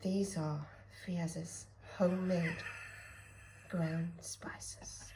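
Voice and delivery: soft, hushed luxury ad read; lightly voiced food advert. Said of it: "This is gonna sound like a Marks and Spencer's advert".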